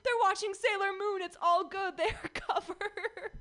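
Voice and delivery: high, laughing mock-carefree mom; breezy mom, laughing it off; imitating mom. Mom recalling leaving the kids with the cartoon, high and laughing. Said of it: "she was like".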